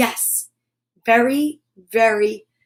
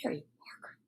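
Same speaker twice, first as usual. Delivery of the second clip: whispered, much lower and softer; ominous whisper; 9 semitones lower, 22 dB quieter, much faster than usual. A narrator's reading. Narrator whispering the rival's name ominously. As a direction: hiss the name like a threat.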